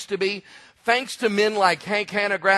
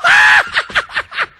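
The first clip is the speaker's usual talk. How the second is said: high hammed-up burst of laughter ending in a loud yelp; over-the-top pretend laughter and shout; mock laughing fit; 6 dB louder than usual. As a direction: fake an uncontrollable laugh for the kids. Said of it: "I smelled it and went".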